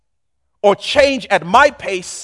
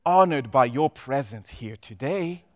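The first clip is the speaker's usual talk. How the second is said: soft, low, grovelling flattery; bow and scrape to the big boss; hushed obsequious greeting; fawning underling; 5 semitones lower, 8 dB quieter than usual. Acted. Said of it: "the C E O walks in".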